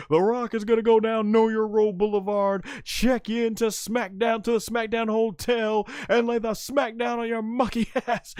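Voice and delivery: trash-talk like a cocky showman; hammed-up Rock promo; The Rock impression. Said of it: "when The Rock's like".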